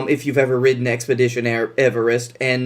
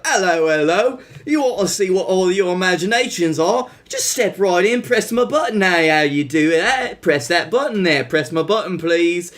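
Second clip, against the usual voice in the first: put-on lightly done British accent, inviting; a British guard luring kids in; British royal guard. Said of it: "this British, uh, royal guard pops out".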